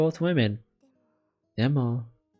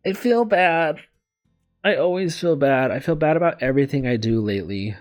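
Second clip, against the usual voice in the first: guilty lament; 5 semitones higher, 3 dB louder than usual. Real, not acted. Guilt over dropping the game.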